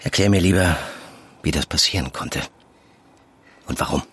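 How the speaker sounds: low, calm probing. Acted. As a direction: coax the truth out gently. Ruhiges Nachfragen im Auto. (ruhig und tief nachfragend)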